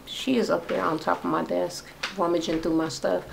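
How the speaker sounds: softly complaining about the pet on the desk; quiet aside. Real, not acted. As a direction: drop the voice to tell on the pet.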